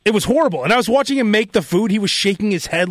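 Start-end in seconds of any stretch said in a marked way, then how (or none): none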